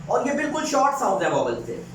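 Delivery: ordinary delivery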